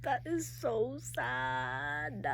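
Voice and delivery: slow, soft, heartbroken; soft, slow reader's lament over the sad chapter. Real, not acted. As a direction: say it slowly, truly moved. Reacting emotionally to book.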